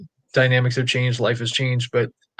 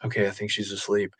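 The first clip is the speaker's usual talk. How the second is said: soft, hushed relief; quiet relieved murmur; 3 semitones lower, 9 dB quieter than usual. Retold or imitated. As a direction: barely voice it so the child stays asleep. Sneaking out of sleeping child's room.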